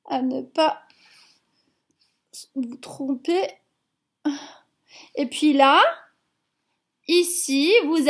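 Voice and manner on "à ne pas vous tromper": chuchoté. Real, not acted. Whispered caution to children.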